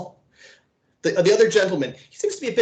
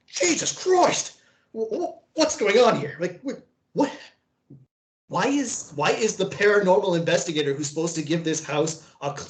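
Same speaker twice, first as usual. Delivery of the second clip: put-on Australian accent. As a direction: sputter in shock with an Australian accent. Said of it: "Was that an Australian accent?".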